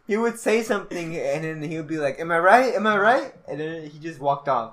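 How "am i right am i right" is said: loud, raised-pitch mimicry of a classmate; imitated pushy catchphrase. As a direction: fire it at people as a mock catchphrase.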